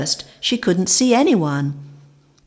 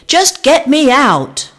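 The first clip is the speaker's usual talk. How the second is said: loud, high urgent scream; 8 semitones higher, 8 dB louder than usual. A narrator's reading. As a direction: demand rescue at full voice. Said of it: "screamed Vic".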